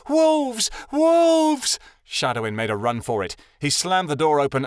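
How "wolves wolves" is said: shrill, very high full-throated alarm shout. A narrator's reading. Scene: Amira alerting the whole city, screaming. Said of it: "opened her big mouth and shouted".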